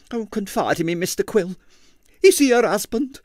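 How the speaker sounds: high, breathless curiosity; prying landlady. A narrator's reading. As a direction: lean in, thrilled by gossip.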